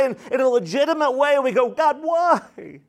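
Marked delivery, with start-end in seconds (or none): 1.7-2.8 pleading cry. Acted. Crying out why to God.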